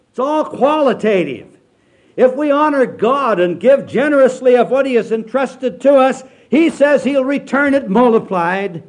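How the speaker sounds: full-force revival preacher; preaching at full force. Acted.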